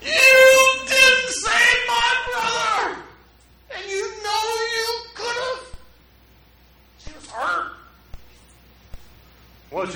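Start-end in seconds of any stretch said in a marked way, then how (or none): 0.0-5.8 high, slow wailing sob, sung out; wailing grief. Retold or imitated. Mary wails at Jesus for her dead brother, high sung sob. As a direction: wail it like a grieving sister. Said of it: "she wasn't gonna let Jesus off the hook".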